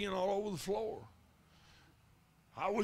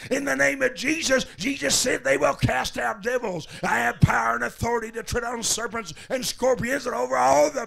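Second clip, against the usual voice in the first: loud preacher's rebuke, peaking on come out; full-voiced deliverance shout, rising to the end; shouted exorcism; 5 semitones higher, 11 dB louder than usual. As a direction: bellow the command at the devil. Casting out devil, shouting again.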